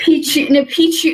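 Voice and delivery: "Peach you" with a clear separate "you" sound at the end; correcting pronunciation. Shown as a demonstration. Guest insisting on her own name. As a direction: say "Peach you", Peach then the letter U. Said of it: "just Peach with a U in the end".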